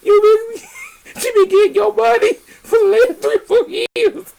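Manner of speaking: high cry through laughter; laughing. Real, not acted. Cracking up while teasing the friend about her money. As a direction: squeal the words while cracking up.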